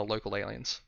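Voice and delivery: usual speaking voice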